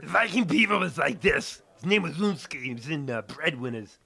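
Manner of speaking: high, loud cartoon beaver voice; Viking beaver voice. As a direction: bellow like a goofy Viking critter. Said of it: "Can we hear a, a Viking beaver?".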